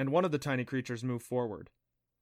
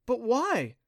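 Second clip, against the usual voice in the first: high-pitched pouty protest; pouting plea; 11 semitones higher, 5 dB louder than usual. A narrator's reading. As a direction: whine it up high, a little louder. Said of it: "Clancy pouted".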